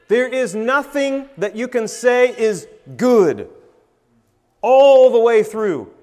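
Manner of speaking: loud, high, slow emphatic preaching; impassioned, stretched-out declaration rising in volume; carried away. Real, not acted. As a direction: proclaim it, building to a drawn-out shout. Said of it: "I'm getting a little carried away in my meditation".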